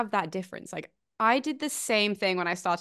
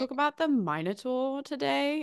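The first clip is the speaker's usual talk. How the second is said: awkward early presenter; 6 semitones higher, 3 dB quieter than usual. Retold or imitated. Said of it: "it's the same thing".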